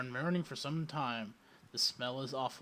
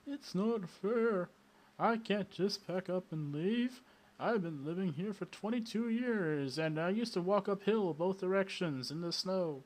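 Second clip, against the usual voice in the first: put-on wailing old man; 6 semitones higher than usual. A narrator's reading. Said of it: "The old man wails".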